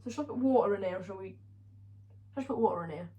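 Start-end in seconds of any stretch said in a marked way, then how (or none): none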